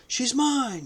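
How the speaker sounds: high possessive howl. A narrator's reading. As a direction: howl out a claim. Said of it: "he howled".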